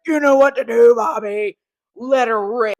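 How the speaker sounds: loud, brutal shout over the speaker; mean dad yelling. Retold or imitated. Mean dad urging his son over the loudspeaker, shouting. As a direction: bellow it like a cruel coach father. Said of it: "the mean-ass dad".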